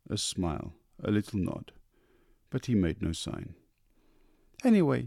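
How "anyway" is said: high, light wife's voice; coaxing wife. A narrator's reading. Wife coaxing husband about supper, high. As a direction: coax him gently upward.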